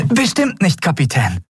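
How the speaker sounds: high, delighted reassurance; beaming with joy. A narrator's reading. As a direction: promise it joyfully in a high voice. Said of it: "erklärte Miko freudestrahlend". (freudestrahlend, mit hoher Stimme)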